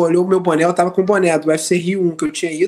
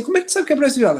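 surprised; 8 semitones higher, much faster than usual. Retold or imitated. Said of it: "Eu falei assim".

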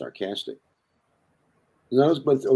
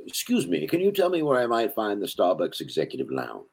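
mock-proper British gentleman; British character. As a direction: ask grandly in a put-on British accent. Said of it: "this British character".

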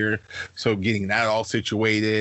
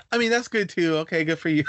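high mock grandma voice; imitating grandma; 7 semitones higher than usual. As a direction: play grandma conceding, voice pitched up. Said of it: "And Grandma's like".

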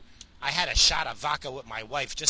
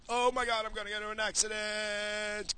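hammed-up fear of a crash; mock panic; 6 semitones higher, 5 dB quieter than usual. Mock panic while driving.

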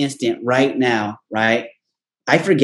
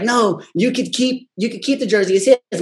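coach's voice; 6 semitones higher than usual. Retold or imitated. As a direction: gently let the boy keep his jersey. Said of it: "the coach is like".

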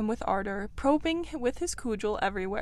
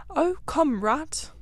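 higher-pitched impatient urging; exasperated call, pitched up; 7 semitones higher, 3 dB louder, slower than usual. A narrator's reading. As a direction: hurry a dawdling friend along. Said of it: "the mole waited impatiently".

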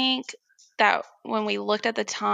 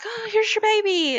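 much higher, bright sing-song announcement; cheerful doctor; 12 semitones higher than usual. Retold or imitated. Doctor presenting the baby to the mother, cheerful sing-song. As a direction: present the baby like happy news. Make it ... a good moment.

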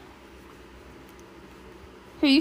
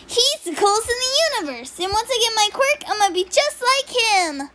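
put-on much higher, little boy gushing about his hero; Baby Deku; 9 semitones higher, 3 dB louder than usual. Shown as a demonstration. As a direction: squeak it out like an excited small kid. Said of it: "Baby Deku".

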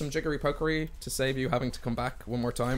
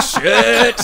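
loud, high, laughing yelp; retold alarm; 8 semitones higher, 13 dB louder than usual. Game master retells reacting to the damage, loud laughing alarm. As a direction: burst out with the curse word, half laughing. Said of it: "I was like".

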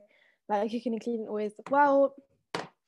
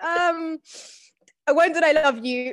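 put-on dismissive girlfriend; 5 semitones higher, 7 dB louder than usual. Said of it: "she'll be like".

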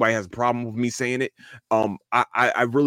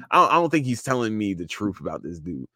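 usual speaking voice

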